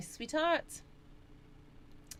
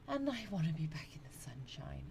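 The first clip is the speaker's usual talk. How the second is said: whisper; 2 semitones lower, 7 dB quieter than usual. Real, not acted. Wistful aside about the sunny holiday.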